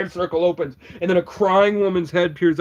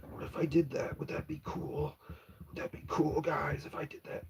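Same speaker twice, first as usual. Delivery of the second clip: unvoiced whispered questions; 14 dB quieter than usual. Real, not acted. Whispering to the viewers about stacking his hair. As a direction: whisper it like a secret idea.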